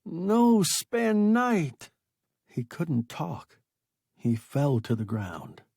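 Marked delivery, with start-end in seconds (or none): 0.0-1.9 very slow, high, loud plea; terrified broken plea. A narrator's reading. Stranded man begging rescuers not to stay, terrified plea. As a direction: beg them, barely able to talk. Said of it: "face melting with terror".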